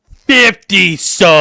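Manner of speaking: big, drawn-out shout; loud, high celebratory call; shouted announcement. Real, not acted. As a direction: bellow it like a hype announcer. Calls out a subscription gift.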